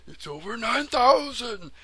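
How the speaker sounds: high, loud over-the-top anime yell; meme shout. Retold or imitated.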